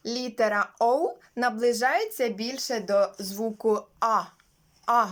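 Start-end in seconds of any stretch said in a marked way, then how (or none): none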